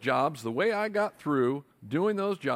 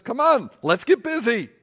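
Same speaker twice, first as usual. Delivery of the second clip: higher, louder rallying call; mock urging; 8 semitones higher, 7 dB louder than usual. Hunger urging the lazy man to work, lively and loud. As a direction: hunger rousing you to work.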